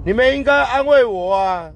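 you should comfort me, pushed up high and loud. Shown as a demonstration.

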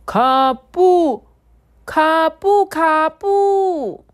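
high, loud lively calls; calling out. Acted. Sparrows overhead call to Kabu, high loud chirpy calling. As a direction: call down cheerfully from the sky.

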